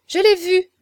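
high, loud exclamation; exclaiming. A narrator's reading. La Fleury breaking into gossip about Lafleur, exclaiming loudly. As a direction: burst in with a high, loud cry. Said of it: "s'écria Lafleurie".

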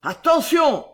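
loud, high shouted warning; hurlement. A narrator's reading. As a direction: yell it as a threat. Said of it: "hurla Belgodère". (hurlement menaçant)

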